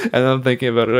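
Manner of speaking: ordinary talk, nothing marked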